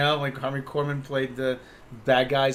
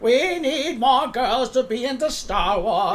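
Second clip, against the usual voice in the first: slow, high mocking executive voice, much higher; mocking studio exec; 11 semitones higher, slower than usual. Co-host mocks studio executives in a high, slow, exaggerated voice. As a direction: drag it out like a smug studio suit.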